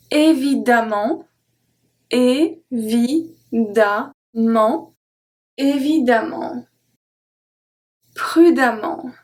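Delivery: évidemment, prudemment, E said as A; model pronunciation. Shown as a demonstration. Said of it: "pronounced A".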